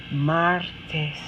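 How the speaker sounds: ordinary delivery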